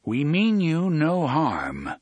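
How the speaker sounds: calling out. A narrator's reading. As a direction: call it out slowly to strangers. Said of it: "the gunslinger called".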